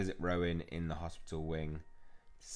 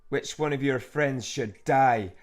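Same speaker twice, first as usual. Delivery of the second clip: slow, loud, raised menace; possessed villain; 6 semitones higher, 10 dB louder, slower than usual. Acted. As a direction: draw out the deadly question loudly. Villain's threat in the game.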